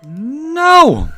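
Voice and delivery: broken scream. Acted. June seeing the body, breaking into a piercing scream. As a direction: shriek it like she's losing her mind. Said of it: "Suddenly, June was screaming, her voice broken".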